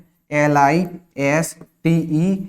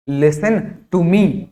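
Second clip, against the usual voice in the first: listen to me, each word drawn out; slow model phrase; 2 semitones higher, 3 dB louder, much slower than usual. Shown as a demonstration. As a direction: say it slowly for learners. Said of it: "मेरी बात सुनो". (धीरे-धीरे खींचकर बोलें)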